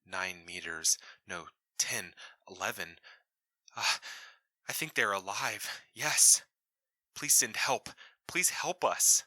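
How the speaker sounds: frantic report. Acted. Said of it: "in frantic Russian".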